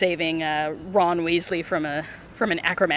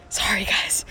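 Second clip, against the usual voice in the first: sheepish whisper, sorry guys; a little whispered sorry to the viewers; whispered apology; slower than usual. Real, not acted. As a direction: breathe a quick apology. Apologising to Irish, Scottish viewers.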